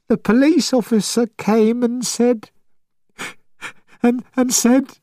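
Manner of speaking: high, tearful mother; tearful, breaking down. Acted. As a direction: voice breaking into tears. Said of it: "She started to cry".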